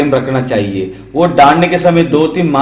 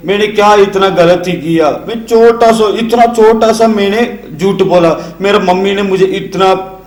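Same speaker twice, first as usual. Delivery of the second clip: mock sulking kid, pitched up; sulky child; 5 semitones higher than usual. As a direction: whine like a small child caught lying. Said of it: "ऐसे आपने बोलना ही नहीं". (रूठे बच्चे की हल्की नकल)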